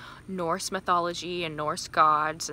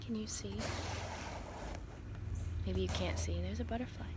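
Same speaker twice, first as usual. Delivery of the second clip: hushed; 10 dB quieter than usual. Real, not acted. Hushed while watching butterfly.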